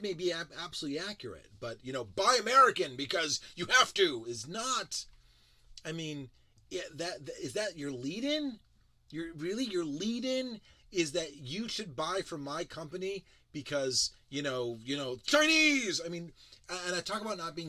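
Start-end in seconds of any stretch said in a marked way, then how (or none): 2.0-4.2 loud, punchy mock slogan; mock sales pitch. Mocking a drone ad's pitch, loud hammed-up slogan. 15.2-16.0 sudden high, loud mock outburst; mock shout. Parodying a fearmongering ad, high mock yell. Mocking the ad's fear pitch.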